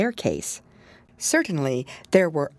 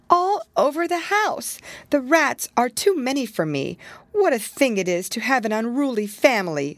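fretful mother cat; 7 semitones higher than usual. A narrator's reading. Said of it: "said Missus Tabitha Twitchit".

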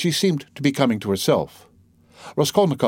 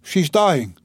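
higher-pitched cry from a crowd; shouted; 6 semitones higher, 3 dB louder than usual. A narrator's reading. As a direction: call out the alarm. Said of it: "someone shouted".

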